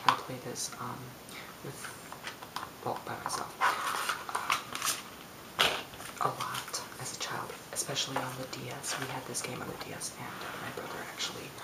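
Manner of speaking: ordinary talk, nothing marked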